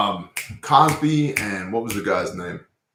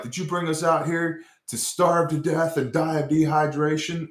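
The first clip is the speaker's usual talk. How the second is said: higher, nagging put-on voice; whiny complaint; 6 semitones higher than usual. The Israelites complaining to Moshe, in a higher whiny voice. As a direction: whine it like a griping crowd. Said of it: "They're constantly bitching".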